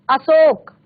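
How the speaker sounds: अशोक with plain s, "asok"; hiss-free of sh, say अशोक as "a-sok"; wrong pronunciation. Shown as a demonstration. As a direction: swap the sh in अशोक for s. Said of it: "लेकिन जबकि सही उच्चारण".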